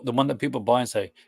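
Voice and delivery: ordinary talk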